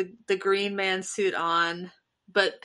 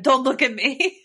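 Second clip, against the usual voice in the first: higher, louder mock yell, breaking into laughter after; mock-indignant raised voice; retold yell; 5 semitones higher, 5 dB louder than usual. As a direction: shout it out in playful retelling. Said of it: "I was just yelling at him".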